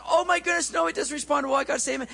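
high-pitched frantic realization; panicking. Acted. Rider suddenly remembering the stop word, high and panicked. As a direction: blurt it out in acted panic. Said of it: "He starts panicking".

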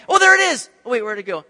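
high, loud "oh, there it is" then puzzled; excited spotting. Retold or imitated. Disciples glimpsing the figure again, excited then puzzled. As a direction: point and lose it again.

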